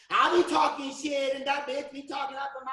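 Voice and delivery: high, mouthy mock girl; imitated girl. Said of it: "like a girl says".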